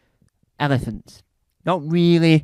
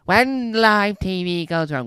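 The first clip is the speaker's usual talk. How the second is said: dramatic TV show title; 4 semitones higher, 4 dB louder than usual. Pretend.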